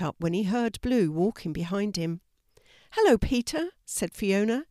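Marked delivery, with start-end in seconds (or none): 2.9-3.7 high, eager hello to a friend; bright greeting. A narrator's reading. Girl greeting the postman, high and eager. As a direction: call out a happy hello.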